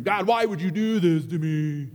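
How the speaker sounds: loud, whining complaint to God; whiny man child. Retold or imitated. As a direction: whine it like a big baby. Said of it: "I sound like a big baby".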